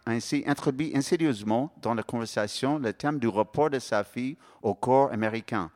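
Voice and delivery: usual speaking voice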